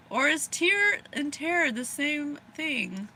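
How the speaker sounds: usual speaking voice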